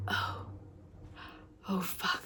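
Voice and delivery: breathless, hushed whisper of shock; quiet whispered disbelief at a name in the book; stunned whisper. Retold or imitated. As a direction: mouth it under your breath, floored. Stunned reaction to the list of names.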